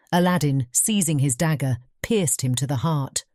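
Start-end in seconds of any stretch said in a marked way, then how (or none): none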